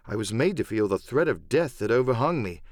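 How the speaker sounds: ordinary delivery, nothing marked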